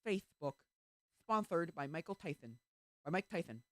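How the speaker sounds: loud lisp, S turned to "th"; Mike Tyson lisp. Retold or imitated. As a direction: say "Tyson" as "Tython", lisping throughout. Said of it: "He put the S in lisp".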